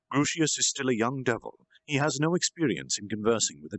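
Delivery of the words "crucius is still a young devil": plain reading voice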